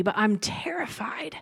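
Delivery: hushed, fearful whisper to God. Retold or imitated. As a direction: breathe it out like a scared confession. Retold prayer confessing fear.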